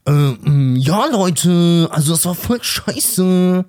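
lightly imitating a blunt TV judge; a hint of a dismissive talent-show judge; [name]. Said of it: "Oder so ein [name]".

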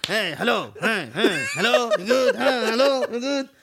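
swooping, singsong greeting calls; loud mock hellos with bobbing pitch. Comic retells a rapper greeting the room, mock singsong. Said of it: "kommt er so und sagt so".

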